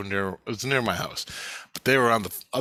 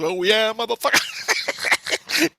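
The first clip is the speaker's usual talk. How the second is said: loud, high, drawn-out last word; mock defiant comeback; 11 semitones higher, 6 dB louder, much slower than usual. Storyteller voices friend bending for a rock, mock defiance. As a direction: imitate a friend rising to the challenge. Imitates friend answering the kid's threat.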